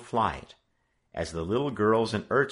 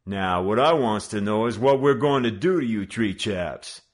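louder mock-judge gang leader; judicial tone; 3 semitones higher, 7 dB louder than usual. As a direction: pass sentence like a street judge. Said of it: "in a judicial tone".

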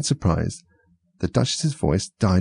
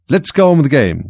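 bossy queen voice; 6 semitones higher, 6 dB louder than usual. A narrator's reading. Queen commanding.

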